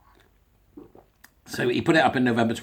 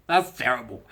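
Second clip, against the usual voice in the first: ordinary delivery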